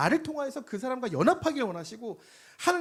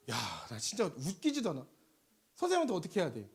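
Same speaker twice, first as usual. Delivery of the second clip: ordinary talk, nothing marked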